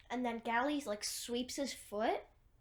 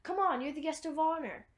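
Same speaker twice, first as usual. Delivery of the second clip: higher, louder acted invitation; sudden change of attitude; 5 semitones higher, 6 dB louder than usual. Newt, upset before, invites the guest of honor, suddenly upbeat. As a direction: switch abruptly into a louder, higher coaxing voice. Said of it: "completely changes his attitude".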